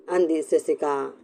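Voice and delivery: ordinary delivery, nothing marked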